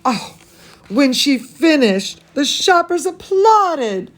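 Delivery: ordinary delivery, nothing marked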